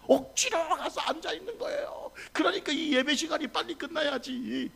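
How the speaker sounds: high-pitched mock whine of a reluctant churchgoer; grudging complaint. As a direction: grumble impatiently, pitched well up. Acting a reluctant, impatient worshipper. (억지로 앉아 투덜대는 높은 목소리)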